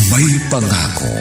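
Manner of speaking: ordinary talk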